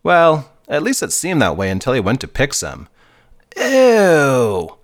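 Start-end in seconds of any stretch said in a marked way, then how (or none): 3.6-4.8 high disgusted squeal. A narrator's reading. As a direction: recoil from the stink. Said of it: "exclaimed Joey".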